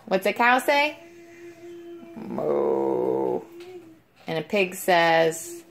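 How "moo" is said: very low, soft drawn-out moo; cow moo. Shown as a demonstration. Answering what a cow says.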